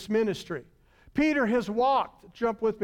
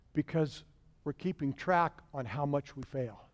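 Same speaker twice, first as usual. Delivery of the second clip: hushed confession; 8 semitones lower, 7 dB quieter than usual. Real, not acted. Quiet confiding point.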